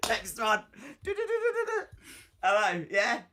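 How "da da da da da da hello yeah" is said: mock phone call, higher. Acts a second phone call.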